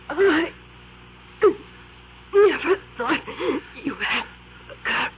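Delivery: high, breaking voice; stricken cry. Acted. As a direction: let the shock crack the voice. Witch shot, voice breaking.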